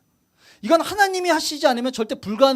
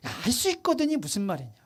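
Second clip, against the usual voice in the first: quiet rebuke; 5 semitones lower, 9 dB quieter than usual. Acted. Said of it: "예수님 뭐라 그럽니까?".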